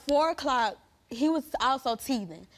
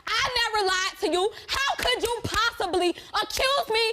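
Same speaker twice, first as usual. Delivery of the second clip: much higher, pressing angry rant; furious accusation; 8 semitones higher than usual. Real, not acted. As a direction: defend yourself, firing back at the accuser. Heated onstage confrontation.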